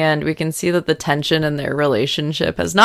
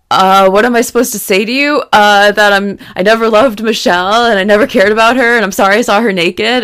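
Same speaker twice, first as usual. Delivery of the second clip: a hint of a flustered Sid, fast, high and louder; 4 semitones higher, 5 dB louder, faster than usual. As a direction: blurt it out fast like a guilty boyfriend. Retelling Sid's awkward confession.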